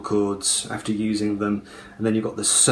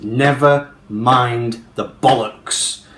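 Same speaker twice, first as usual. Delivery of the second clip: "Never mind the bollocks" slow and loud, beat by beat; "Never mind the bollocks" in heavy falling stresses; downward series of stresses; 5 dB louder, much slower than usual. Shown as a demonstration. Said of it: "very downward series of stresses".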